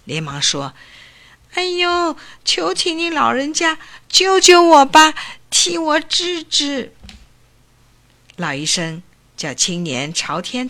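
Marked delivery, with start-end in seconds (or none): 1.5-6.9 put-on high, anxious young man begging for help; frightened pleading. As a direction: beg the old doctor to save you. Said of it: "那青年听了害怕起来， 连忙说".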